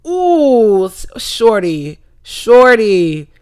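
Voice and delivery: drawn-out put-on "shorty", sliding down; tut-tut at her like an auntie; theatrical disappointed scold; mock scolding.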